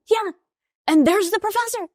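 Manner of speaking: high-pitched dismissal, re-enacted; mock exasperation. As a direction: send them off in a high, insistent voice. Retold exam quarrel with husband.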